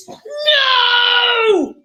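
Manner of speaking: high, loud horrified no; screaming no. Acted. Prince screams as the sister tries the shoe. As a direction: scream it in horror. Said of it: "the prince screamed".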